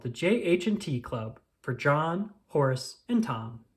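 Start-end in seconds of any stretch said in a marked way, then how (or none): none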